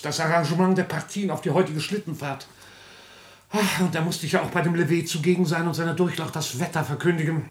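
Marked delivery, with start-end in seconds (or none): 3.6-7.5 very fast, self-important prattle; fussy rapid chatter. Acted. Court marshal babbling about his busy morning. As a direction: rattle it off breathlessly.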